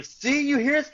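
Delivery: high, pressing question; urging friends to listen; urgent retold plea. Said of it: "And I was going".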